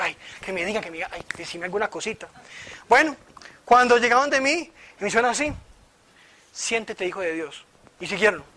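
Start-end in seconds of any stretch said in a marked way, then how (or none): 0.0-2.3 soft, low little plea to oneself; retold inner pleading. Speaker relives waiting for a word at the retreat, quietly pleading. 6.5-7.6 soft, low pronouncement; imitated prayer word. Retreat leader speaks over him in prayer, softly imitated.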